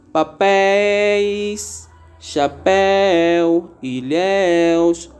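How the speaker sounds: papéis, chapéu, ilhéus, roxinóis said very slowly, each open diphthong stretched; slow word-by-word example reading; slow teaching list. Shown as a demonstration. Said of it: "repare que esses ditongos abertos".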